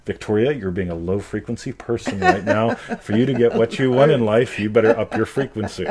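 mock scolding. Acted scolding of Victoria, mocking idea.

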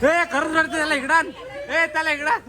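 high excited call across the room. Real, not acted. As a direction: call them over, high and eager. Calling friends over, crying relative.

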